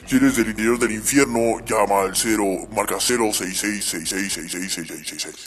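anuncio más fuerte de locutor; locutor de radio. Acted. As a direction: vende el programa como locutor entusiasta. (locutor de radio infernal anunciando en voz alta)

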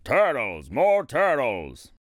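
mild low bellow, drawn out. A narrator's reading. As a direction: call out a slow kingly demand. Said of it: "he bellowed and brayed".

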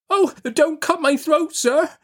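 put-on very high, terrified little boy; pleading in terror. A narrator's reading. Pip begging the man who seized him, pleading in terror. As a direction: beg for your life in a high child's voice. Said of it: "I pleaded in terror".

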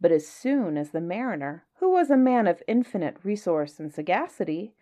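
lively storybook reading with playful lilt; story narration. A narrator's reading. Reading a Kipling story to listeners, lively storybook voice. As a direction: read it like a bedtime tale to children. Reads Just So story aloud.

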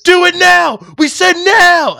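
high, loud barking order, mocking; imitated guard yell. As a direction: yell it like a jail guard bullying a kid. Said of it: "And they're like".

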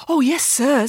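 high, eager-to-please schoolgirl; happy. Acted. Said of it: "said Mirabella happily".

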